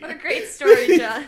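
loud, laughing over-the-top praise; loud mock praise. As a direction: gush loudly while laughing. Mock praise after silly story.

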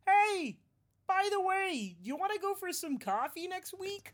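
high, soft little frog voice. Acted. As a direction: sneak back in as a shy frog. Frog character returns, asks coffee.

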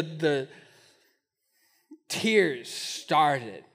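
usual speaking voice

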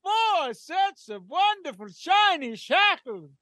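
high, slow gleeful counting shout; Count von Count voice. Acted. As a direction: relish every shackle like a vampire.

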